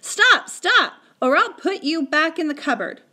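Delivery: high, loud alarmed command; urgent shouted threat. Acted. Omri stopping Little Bear chopping the chair, urgent shout. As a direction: cry out sharply, then threaten.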